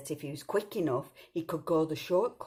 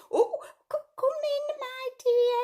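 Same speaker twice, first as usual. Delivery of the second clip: much higher put-on granny voice; wolf pretending Grandma; 5 dB louder than usual. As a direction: coo like a fake grandma. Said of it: "pretending to be Grandma".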